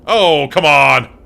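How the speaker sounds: real exasperation at the game; exasperated shout. Real, not acted. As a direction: drag out a fed-up "oh, come on". Frustrated outburst at game.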